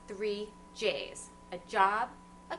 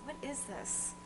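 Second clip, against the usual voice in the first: normal talking voice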